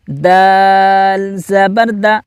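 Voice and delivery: very slow, deliberate letter-by-letter chant; slow recitation drill. Shown as a demonstration. Teacher leading pupils in slow drill. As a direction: recite each syllable for the class to repeat. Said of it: "میں آپ کو پڑھ کر بتاتا ہوں".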